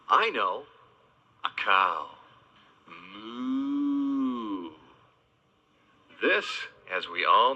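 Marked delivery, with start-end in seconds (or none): 2.9-4.7 cow moo. Pretend. Imitating a cow.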